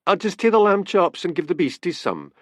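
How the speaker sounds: high, cheerful lilt. A narrator's reading. Man offering to feed the pets, cheerfully. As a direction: chirp it out happily. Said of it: "said Dick cheerfully".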